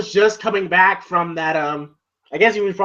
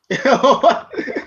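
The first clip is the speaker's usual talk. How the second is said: giggle; 6 semitones higher than usual. Real, not acted.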